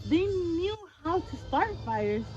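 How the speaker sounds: ordinary delivery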